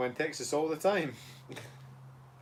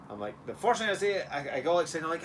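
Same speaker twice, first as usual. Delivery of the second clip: ordinary delivery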